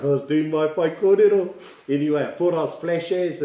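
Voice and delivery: laughing. Real, not acted. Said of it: "laughing at himself".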